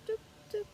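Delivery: "Toop, toop" in small high, soft notes; light, high demonstration syllables; fall-rise tune. Shown as a demonstration. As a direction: sketch the tune softly on "Toop, toop". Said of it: "Like this".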